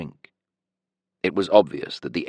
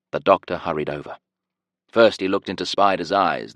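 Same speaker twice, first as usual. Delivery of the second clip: usual speaking voice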